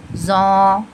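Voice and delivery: "जौ" held long with a rounded "o"; long, full "जौ" coloured with "o"; heavy "o" sound. Shown as a demonstration. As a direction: fill the mouth on "जौ". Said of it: "ओ की sound आते हुए हम अदा करेंगे".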